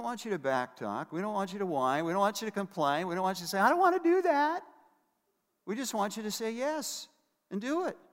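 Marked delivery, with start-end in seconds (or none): 3.6-4.6 put-on high, loud whining child; mock whining kid voice; whiny child. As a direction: whine it like a sulky kid. Imitating child's refusal.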